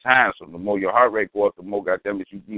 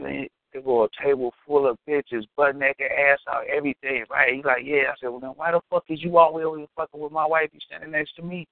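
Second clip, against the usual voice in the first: mock indignant confrontation; 6 semitones higher than usual. Confronting the man.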